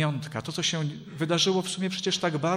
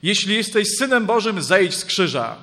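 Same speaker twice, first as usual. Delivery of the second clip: loud, raised voice of jeering passers-by; reviling taunt; 5 semitones higher, 7 dB louder than usual. A narrator's reading. Passers-by cursing the man on the cross, loud taunt. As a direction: hurl it out as a loud, emphatic challenge. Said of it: "przeklinali go i potrząsali głowami".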